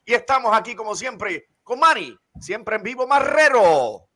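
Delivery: high, booming bilingual ring announcer hype; lucha libre announcer. Acted. Podcast intro hype announcer.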